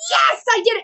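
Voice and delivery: lightly voiced excited little kid. Cheering at herself like a little kid on stage. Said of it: "like a little kid goes".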